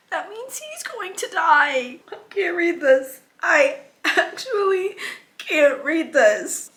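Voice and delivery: high, tearful and slow, breaking into a whisper; crying. Real, not acted. Reader to her viewers as Liam's dragon dies, crying. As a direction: cry through the words as the dragon dies. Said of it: "Am I crying over a dragon right now? Yeah, I am".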